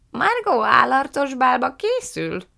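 slow, high, loud aunt voice; Fan néni. A narrator's reading. Fan néni asks about a masked ball, high curious voice.

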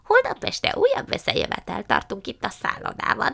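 high put-on character voice; Missus Flecken. A narrator's reading. Missus Flecken invites mama to the hotel meeting, high put-on voice. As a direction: announce it in a high, affected character voice.

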